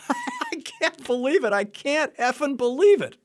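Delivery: disbelieving laugh, voice soaring; laughing disbelief. Retold or imitated. Veteran laughing in relief at the therapist, high and amazed. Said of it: "he's just sitting there going".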